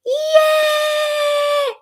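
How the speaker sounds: long, loud, high-pitched cheer; shouted yay. Pretend. Streamer interrupting own talk, shouted high yay.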